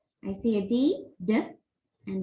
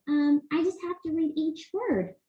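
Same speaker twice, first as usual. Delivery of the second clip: Leo thinks it through, a little higher; puppet voice; 6 semitones higher than usual. Acted. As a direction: puppet Leo answers the teacher, lightly high. Leo answering.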